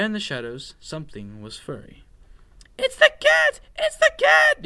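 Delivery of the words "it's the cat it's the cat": very high, loud mouse shriek; panicked cry. A narrator's reading. Scared mouse spots a stripy tail, shrieking in panic. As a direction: squeal the alarm at the top of your voice. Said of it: "he cried".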